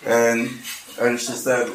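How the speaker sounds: normal talking voice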